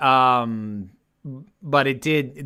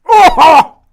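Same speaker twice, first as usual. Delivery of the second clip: disgusted shout; 14 semitones higher, 16 dB louder than usual. Real, not acted. Tasting a bad jelly bean.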